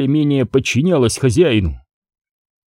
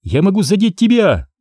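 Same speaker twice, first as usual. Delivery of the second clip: shouting; 7 semitones higher than usual. Acted. Sheva shouts back in battle.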